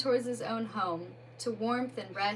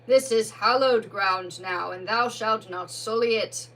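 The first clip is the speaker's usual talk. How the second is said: solemn Badger voice; 7 dB louder than usual. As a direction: Badger lays a restless soul to rest, loudly. Badger banishes the ghost.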